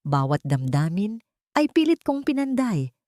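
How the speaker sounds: ordinary delivery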